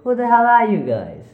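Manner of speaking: shocked, high and loud; startled yell. Acted. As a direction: jump out of your skin. Startled by voices, yelling back.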